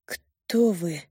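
trembling voice. Acted. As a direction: let the voice shake with weakness. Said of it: "Мой голос дрожит".

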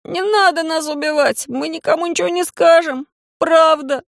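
high, pleading falsetto voice; thin falsetto. Acted. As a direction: beg in a thin squeak. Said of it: "донеслось тоненьким фальцетом".

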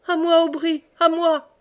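high, loud desperate call; crying for help. A narrator's reading. Reine calls Aubry to save her, shouting high. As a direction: shout his name across the water. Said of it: "répéta la jeune fille".

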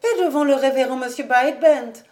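loud, high cry of reproach; sharp wifely rebuke; shocked exclamation. A narrator's reading. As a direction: exclaim in scandalised protest. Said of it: "s'écria mistress Girder".

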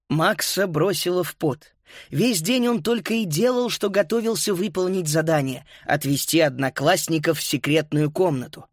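ordinary delivery, nothing marked

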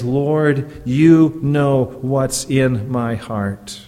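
slow it right down, Peter confessing; slow and earnest prayer to his master; slow, heartfelt plea. Acted. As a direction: plead slowly, Lord, you know what's in my heart. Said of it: "what he is saying is".

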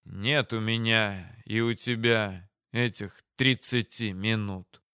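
low, soft, slow and hopeless; doomed resignation. A narrator's reading. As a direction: give up quietly, weighing out the words. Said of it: "обречённо ответил Козлов".

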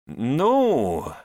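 drawn-out hesitant filler; stall, unsure how to go on. A narrator's reading. Herbalist pressed about the spear blow, hesitating. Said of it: "замялся травник Иван".

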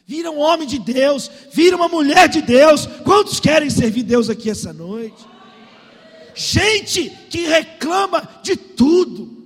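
loud, rousing challenge; full-force preaching. Real, not acted. Pastor challenging the church to serve God, forceful preaching. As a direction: shout the call to the crowd.

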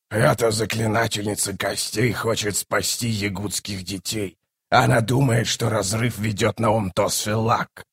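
lightly voiced low sorcerer; a hint of a sombre shaman; grave bone caster.

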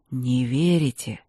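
slow, low menacing growl; a hint of a threatening beast. A narrator's reading. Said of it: "прорычал он".

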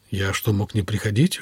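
plain reading voice

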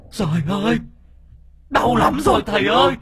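very high, piercing ghost howl rising to a shriek; a demon screaming under the master's spell; howling in pain. Acted. The ghost in Xuân howls in pain as the master presses. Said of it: "nó rú lên".

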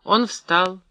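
plain reading voice

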